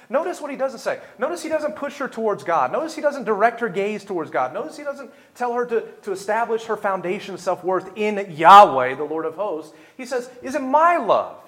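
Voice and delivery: loud, high, driving sermon voice; full-force preaching. Real, not acted. Preacher showing the congregation what Elkanah doesn't say, full-force preaching. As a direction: preach it hard, building to a peak.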